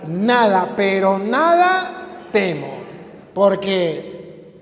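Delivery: loud, slow, soaring recitation; preaching a psalm. Acted. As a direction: proclaim each line of the psalm. Recita el salmo predicando. (predicador proclama el salmo del pastor, fuerte y lento)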